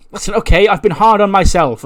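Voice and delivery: acted defensive outburst. [name] snaps back at an accuser, defensive and exasperated. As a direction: push back hard, high and insistent.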